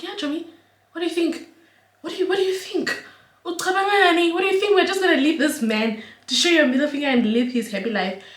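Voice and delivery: high, rising exasperated voice; incredulous scolding. Retold or imitated. To sister who doubts her, high exasperated.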